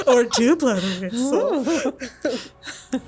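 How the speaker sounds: slow teasing laugh; drawn-out teasing invitation. Acted.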